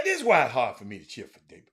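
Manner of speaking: ordinary talk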